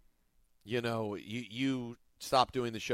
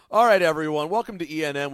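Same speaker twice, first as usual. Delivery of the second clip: show intro; 3 semitones higher, 8 dB louder than usual. Acted. Host opening the show.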